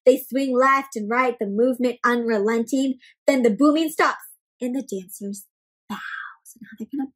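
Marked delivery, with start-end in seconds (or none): 4.6-7.1 soft unvoiced aside; whispered storyteller aside. Real, not acted. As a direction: lean in and whisper the plan. Hushed aside to listeners.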